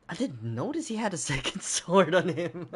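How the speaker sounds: laughing. Real, not acted. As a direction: crack up mid-sentence. Laughs at own mistake.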